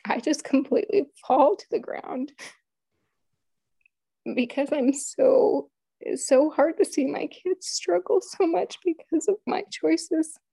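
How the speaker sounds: real tearful voice, high and strained; fighting back tears, pitch climbing; choked up. Real, not acted. Mother recalling her kids struggling because of her, choked up. Recounting her children's suffering, overcome.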